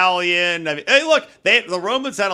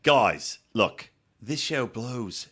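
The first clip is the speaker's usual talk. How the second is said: mock actor pitch; 4 semitones lower, 6 dB quieter, much slower than usual. Actor voiced, low slow.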